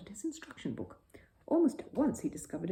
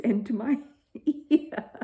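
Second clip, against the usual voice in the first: laughing through words; 5 semitones higher, 8 dB louder, much slower than usual. A narrator's reading. Mr Brown's ear caught in string.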